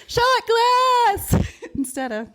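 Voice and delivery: high, slow, laughing through words. Real, not acted. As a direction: crack up on the words. Laughing at wrong lyrics.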